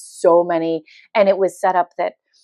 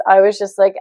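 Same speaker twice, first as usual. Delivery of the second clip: ordinary talk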